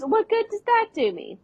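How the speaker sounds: high frustrated complaint; exasperated Lizzie. Retold or imitated. Lizzie frustrated at her blank head, high exasperated voice. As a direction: throw up your hands. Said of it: "She goes".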